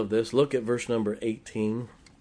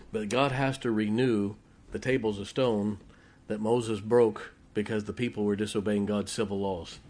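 ordinary talk, nothing marked

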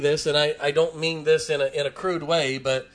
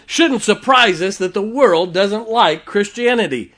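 ordinary delivery

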